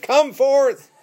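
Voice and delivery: high, ringing command; commanding loud call. Acted. Preacher voicing Jesus calling out the dead, loud high command. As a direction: call it out loudly, as if summoning the dead. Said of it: "called out in a loud voice".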